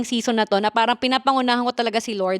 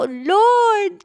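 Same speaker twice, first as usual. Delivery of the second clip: desperate plea; 11 semitones higher, 5 dB louder than usual. Retold or imitated. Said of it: "as in, desperadang".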